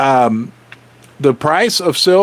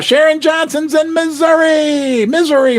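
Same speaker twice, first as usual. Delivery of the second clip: energetic livestream shout-out; excited shout-out; 9 semitones higher than usual. Real, not acted. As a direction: call it out to the chat like a host. Excited live shout-out to viewers.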